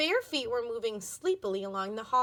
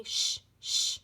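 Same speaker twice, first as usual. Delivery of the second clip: hissed shushing. Acted. Annabelle hushing Tiffany in the backpack, hissing. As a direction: keep hushing her with a hiss. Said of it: "hissed Annabelle suddenly".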